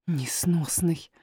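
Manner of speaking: low-volume, slow grumble; soft, drawn-out nurse's grumble; muttering. A narrator's reading. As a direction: mutter it so the boy can't hear. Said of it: "пробормотала няня, но не очень громко".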